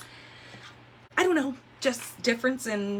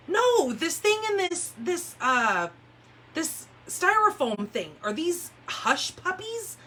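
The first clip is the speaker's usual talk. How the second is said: mock puzzled husband, pitched higher; husband insisting, higher; 9 semitones higher, slower than usual. Husband calling from the kitchen about the food, mock puzzled and higher. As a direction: play the confused husband, higher up. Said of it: "he goes".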